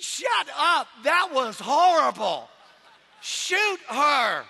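loud, drawn-out comic disgust; mock outraged heckle. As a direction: heckle from the back, hammed up. Acted heckle from the back.

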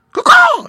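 very high, loud crow call; rascally crow caw. A narrator's reading. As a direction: screech out a crow's caw. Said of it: "he called".

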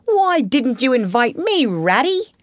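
lightly voiced otter, mock indignation; complaining otter. A narrator's reading. Otter playfully reproaches the rat.

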